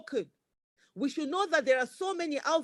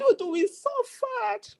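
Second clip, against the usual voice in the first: higher and slow, fighting back tears; tearful, breaking; 9 semitones higher, slower than usual. Real, not acted. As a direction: let the voice crack as it rises. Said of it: "I'm not afraid to cry".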